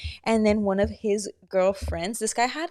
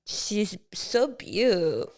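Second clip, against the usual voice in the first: mocking beaut; 3 dB quieter, much slower than usual. Shown as a demonstration. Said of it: "we know in the tone that we say it".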